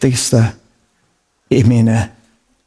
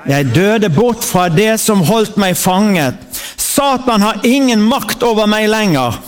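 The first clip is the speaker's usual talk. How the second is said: raised, proclaiming preacher's voice, line by line; preaching at full force; 7 semitones higher, slower than usual. Acted. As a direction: declare each line like a battle cry for the church to echo. Leads congregation in declaring scripture.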